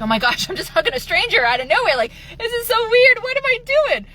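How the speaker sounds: high, incredulous self-questioning; squeaky-high retold disbelief with a laugh; mock panic. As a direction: replay your freaked-out thoughts, pitch leaping up. Mock panic at hugging a stranger.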